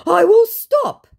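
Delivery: very high, loud, slow mock promise; mock the scammer's pledge; high whiny promise.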